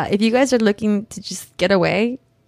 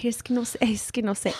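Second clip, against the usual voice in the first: hushed, half-whispered fretting; quiet reenacted helplessness; imitating fretting mom; 8 dB quieter, faster than usual. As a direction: mutter softly like a flustered mother.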